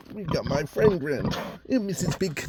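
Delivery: high cooing greeting; greeting the pig in a high cooing voice; pet talk. Real, not acted. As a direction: coo hello to the animal.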